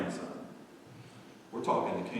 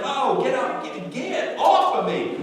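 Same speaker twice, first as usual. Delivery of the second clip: high, loud, hammed-up protest; mock protesting; 13 dB louder than usual. Owner pushing away a licking dog, mock protesting. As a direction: fend off an overeager dog.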